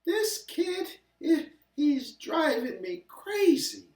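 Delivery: high, loud frazzled mom voice; exasperated mother. Acted. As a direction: play the worn-out mother at wit's end. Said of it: "his mother would say".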